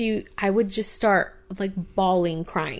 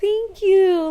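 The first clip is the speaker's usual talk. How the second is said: excited thanks; 12 semitones higher, 5 dB louder than usual. Retold or imitated. Grateful to hummingbirds.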